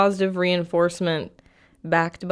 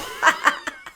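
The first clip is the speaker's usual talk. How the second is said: laugh. Real, not acted. Laughter after yell.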